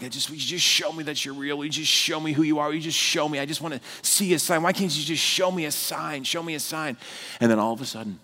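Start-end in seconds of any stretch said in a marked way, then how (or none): 0.0-6.2 softer, fast, retold pleading prayer; begging God alone on a rooftop, hushed. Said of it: "praying to God. I'm like". 6.2-7.0 softer, almost breathed final plea; quietly begging for a sign; hushed plea. Retold or imitated. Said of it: "praying to God".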